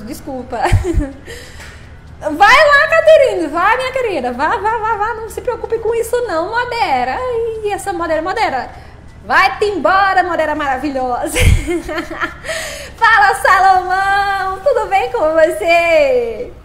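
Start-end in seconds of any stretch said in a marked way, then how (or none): 2.2-5.1 loud, high urging call; real high-pitched holler of encouragement. Real, not acted. Calling out to moderator, urging her. 13.0-16.5 drawn-out, sing-song call; slow, lilting shout of hello; hollered greeting. Real, not acted. Calling out a greeting to viewer.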